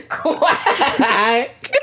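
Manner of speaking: laughing duck quack; Donald Duck voice. Pretend. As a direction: squawk it like Donald Duck, giggling. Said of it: "me see your Donald Duck impression".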